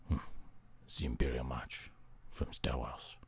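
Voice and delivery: low, very soft whisper; mock hushed answer. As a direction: whisper the title. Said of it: "whispering in library".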